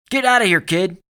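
loud, high-pitched command; shouting. A narrator's reading. Soldier ordering a kid away, shouted loud. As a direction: yell an urgent order at full voice. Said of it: "Alex shouted".

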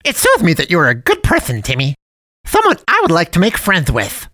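sugary high cartoon voice; jolly cat man. A narrator's reading. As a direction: play the friendly dump cat, overly sweet. Cat man befriends boy.

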